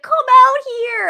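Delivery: high, loud mock call to hidden archers; mock shout. A mocked heroine calls out to her hidden attackers, high and loud. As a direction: yell it out high and loud, hamming it up. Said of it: "she's like".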